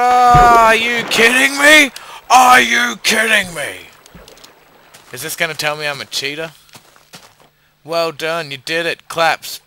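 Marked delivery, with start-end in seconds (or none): 0.0-3.9 loud, high, slow incredulous yell; real gamer outrage at the screen; outraged shout. Real, not acted. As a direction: bellow it in disbelief. Outraged at game.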